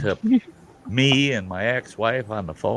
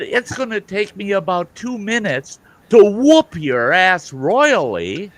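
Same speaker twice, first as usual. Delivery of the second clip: retold angry threat; 8 semitones higher, 4 dB louder than usual. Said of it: "I'm bent out of shape".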